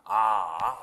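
"아" dipping low, then rising; third tone. Shown as a demonstration. Teacher modelling the third tone, low dip then rise. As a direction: scoop "아" down and swing it back up. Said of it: "삼 성을 삼 성처럼 발음하는".

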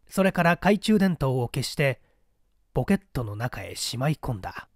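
ordinary delivery, nothing marked

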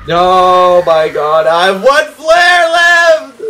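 building from loud to a high, drawn-out yell; real panic, rising to a scream; panicked shout. Real, not acted. Panics at running out of flares.

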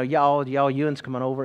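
folksy East Tennessee dialect, lightly put on; East Tennessee accent. Newcomer boy greeting Pennsylvanians, East Tennessee accent. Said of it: "I talked like this".

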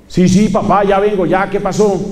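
quick nervous yes-yes to dad; hurried son answering. Retold or imitated. Son rushing to answer his calling father, mildly anxious.

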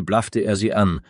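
usual speaking voice

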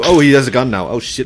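ordinary talk, nothing marked

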